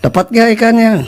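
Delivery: half-hearted shouted question; retold calling out. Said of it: "Terus teriak bapak saya tuh".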